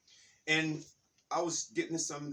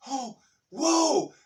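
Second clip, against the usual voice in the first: high, loud wonder; high, loud surprised whoa; amazed whoa; 11 semitones higher, 9 dB louder than usual. Retold or imitated. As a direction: let it out in awe. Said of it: "She said".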